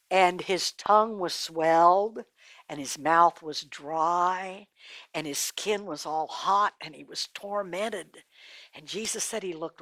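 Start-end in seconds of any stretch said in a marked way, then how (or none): 0.1-8.2 low, soft, slow telling; somber narration. A narrator's reading. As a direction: weigh each misery, drawing it out. Somber account of the dead man's torment.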